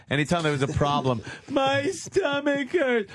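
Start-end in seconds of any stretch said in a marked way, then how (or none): 1.5-3.1 put-on high, slow whining child; whiny kid. Kid whining to his parents, high and drawn out. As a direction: drag it out like a sulky little kid.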